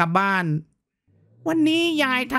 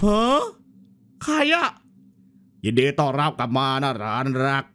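เสียงคุณตาผี; 12 semitones lower than usual. Acted. คุณตาผีทักหลานในเกม.

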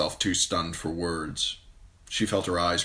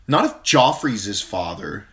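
put-on a touch louder, blunt young girl; tomboy Arya; 3 semitones higher, 7 dB louder than usual. A narrator's reading. Younger sister mocking, lightly voiced. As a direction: scoff at the prince. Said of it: "she said".

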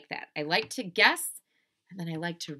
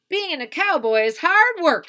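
high, loud cowboy proclamation; Leroy Ninker; 12 semitones higher, 13 dB louder than usual. Acted. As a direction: declare it like a tired little cowboy. Cowboy complaining in story.

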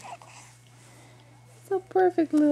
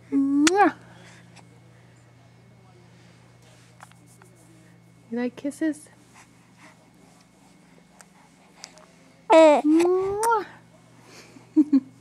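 loud kiss sound; 6 dB louder, much slower than usual. Real, not acted. Kissing the baby.

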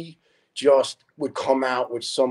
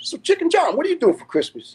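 high-pitched imitated question; Bobby; 14 semitones higher than usual. Bobby asks a coworker about Christmas, high imitated voice. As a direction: put on a high storytelling voice for the question. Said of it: "Bobby is a real good imitator".

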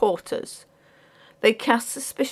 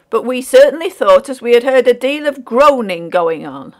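loud, higher working man's voice; defensive porter; 5 semitones higher, 9 dB louder than usual. A narrator's reading. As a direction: protest and justify yourself.

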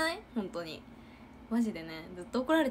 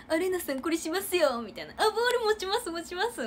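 lightly higher, eager offer; sucking-up junior voice; 8 semitones higher, 3 dB louder than usual. Retold or imitated. Junior fawning over the captain before the coach, a bit higher. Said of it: "調子良さげなんですよ".

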